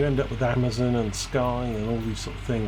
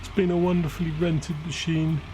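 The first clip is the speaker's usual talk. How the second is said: higher-pitched, lightly hammed-up farewell; mock emotional; 6 semitones higher than usual. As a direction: pretend to get emotional over a rented machine. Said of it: "I don't know why I'm getting emotional".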